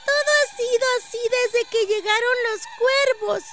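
put-on very high, small child voice; a little girl telling of dreadful things; high-pitched village child. A narrator's reading. Little girl keeps explaining.

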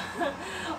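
short breathy chuckle; giggle. Real, not acted. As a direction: chuckle at the joke. Laughs again.

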